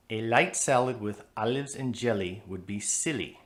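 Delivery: "light", "olives", "jelly", "silly" all with light L's; light L foreign sound. Shown as a demonstration. Teacher showing the foreign sound. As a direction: say every L forward and thin, a foreign accent. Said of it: "All those light L's give that sentence a foreign sound".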